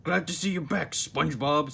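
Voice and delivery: a cheerful boss greeting his worker; Mister Krabs voice. Acted. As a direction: welcome him back, pleased. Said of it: "said Mister Krabs".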